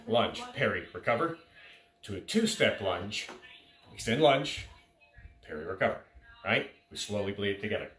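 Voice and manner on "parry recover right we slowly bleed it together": usual speaking voice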